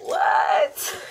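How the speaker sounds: very high delighted shriek; shrieked what. Real, not acted. Vlogger opening her package, screaming with joy. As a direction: scream it out in shock.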